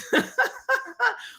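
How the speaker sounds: high-pitched giggle; high laugh. Real, not acted. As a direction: burst into a high, amused laugh. Laughs after greeting Eileen Sideways.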